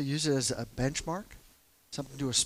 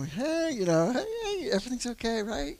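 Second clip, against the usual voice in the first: much higher, nervously reassuring; voice goes way up; 9 semitones higher than usual. Retold or imitated. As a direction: pitch it up high to smooth things over. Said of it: "Voice goes up".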